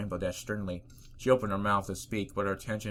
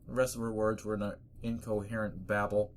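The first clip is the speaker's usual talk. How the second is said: ordinary delivery, nothing marked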